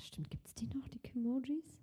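soft unvoiced whisper. Real, not acted. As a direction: whisper it under your breath. Whispered aside about Kimojis.

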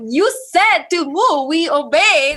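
high, loud complaint hurled at God; indignant protest. Retold or imitated. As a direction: re-enact railing at God, easing slightly by the end. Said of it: "We're throwing a fist at God".

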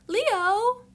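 put-on high, little-kid voice scolding Leo; childlike voice. A tarot reader scolding Leo in a child's voice, high and small. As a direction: squeak it out like a small child telling someone off. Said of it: "I don't even sound my age right now".

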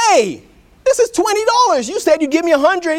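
high-pitched outraged yell; hammed-up cry of being cheated; indignant protest. Lawyer to doctor who handed him twenty dollars, indignant yell.

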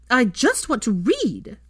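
put-on high, loud girl's outburst, slow; shouted. A narrator's reading. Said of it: "she shouted at Rose".

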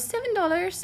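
put-on high, light child's reply; little boy voice. Little boy answering the narrator, high and light. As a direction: chirp it like a young kid.

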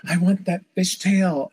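slow, high-pitched imitated swoon; mock gushing. Stylist mimics fans coveting a star's braid, mock gushing. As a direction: gush like an awestruck fan, hammed up.

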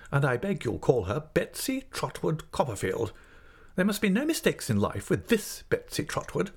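high, swooping, emphatic declarations; domineering aunt figure. Acted. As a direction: lay down the law in a high voice.

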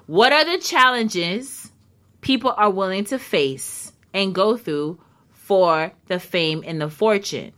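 ordinary talk, nothing marked

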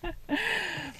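high, soft giggle; a quiet embarrassed giggle; soft laugh. Real, not acted. Laughs after yawning.

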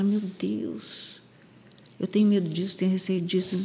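soft, hushed admission of fear; fearful murmur. Shown as a demonstration. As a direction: confess the fear quietly to yourself. Fearful self-talk at the mirror.

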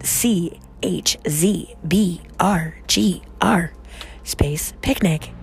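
slow whispered robotic spelling; old speech synthesizer. Pretend. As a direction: spell it out like a machine. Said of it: "That's my computer voice".

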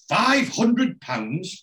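can't believe the sum she heard; shocked disbelief. Retold or imitated. Brenda stunned by her friend's casino winnings, slow high disbelief.

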